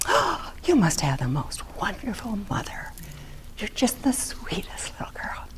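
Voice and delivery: high, hushed, cooing adult voice; sugary adult praise. Retold or imitated. Grown-ups praising the quiet model child, high cooing whisper. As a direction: coo over the good little girl.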